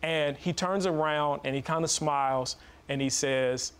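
ordinary talk

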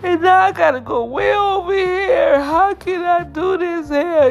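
high, loud, tearful pleading; crying prayer. Retold or imitated. Testifier retelling her desperate prayer, crying loud and high. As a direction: sob it out to God, voice cracking high. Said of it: "I am like".

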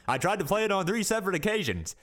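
high-pitched presidential impression; Obama. Podcaster retells his failed playthroughs, high mock-presidential voice. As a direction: put on a president's voice, pitched well up. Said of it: "I haven't done my Obama one yet".

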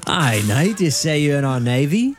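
mock-stately British lord quizzing a knight; medieval British accent. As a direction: put on an old-time British accent. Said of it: "like, a medieval time".

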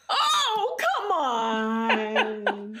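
overwhelmed, half-laughing coo; melting over cuteness. Real, not acted. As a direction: squeal in adoring protest at something too cute. Melting at a puppy photo.

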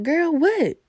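put-on high, loud startled "Girl, what?"; startled shriek. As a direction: jump out of your skin and squeal it. Startled reply to mom screaming.

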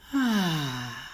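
loud, open release of breath; loud sigh. Shown as a demonstration. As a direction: let it all out in a big sigh. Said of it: "let the breath out with a loud sigh".

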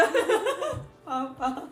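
slow, sung trumpet fanfare; fanfare noise. Pretend. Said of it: "ここファンファレ入れといて".

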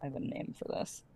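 quiet, fast near-whisper; hushed villain aside. Acted. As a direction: breathe it out secretly. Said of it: "and she says".

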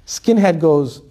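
ordinary talk, nothing marked

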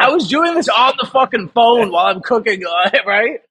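high, giggling aside; laughing. Real, not acted. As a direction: laugh at how absurd it was. Laughing at the absurd situation.

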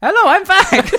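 high, loud put-on voice through a giggle; a giddy time traveller bursting back in; high silly voice. Returning time traveller greets the host, high and giggly.

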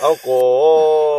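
long stretched loud "Alcohol"; drawn-out mock. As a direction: drag the word out mockingly. Mocking repeat of a word.

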